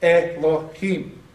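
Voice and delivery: ELOHIM slowly chunked as e-lo-him; slow teaching demo of ELOHIM, syllable by syllable; broken into syllables. Shown as a demonstration. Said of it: "how to break the word up".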